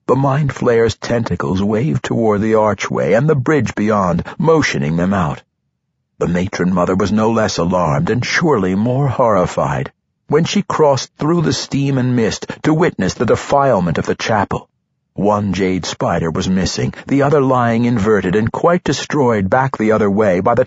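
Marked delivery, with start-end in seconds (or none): none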